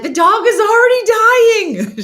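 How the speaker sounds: high loud slowed yell; high screaming mock panic; frantic shout. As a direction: scream the bad news. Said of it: "She's like".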